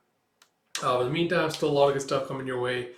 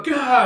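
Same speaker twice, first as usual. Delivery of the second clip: a high, loud gah; battle cry; 12 semitones higher, 11 dB louder than usual. Retold or imitated. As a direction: cry out as he slashes. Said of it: "And you have a".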